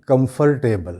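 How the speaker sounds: "कंफर्टेबल" as kam-far-TEBAL; four-syllable "कंफर्टेबल" with the r voiced; common mispronunciation. Shown as a demonstration. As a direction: sound "or" fully in "कंफर्टेबल". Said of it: "तो वो कहते हैं".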